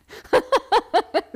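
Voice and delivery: a burst of high, loud laughter; big laugh. Real, not acted. Laughing about loving sleep.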